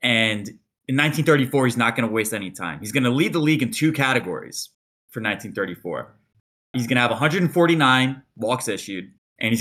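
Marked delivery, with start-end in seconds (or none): none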